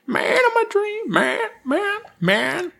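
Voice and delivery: high, searching woman's voice; female voice. Shown as a demonstration. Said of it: "do another female voice here".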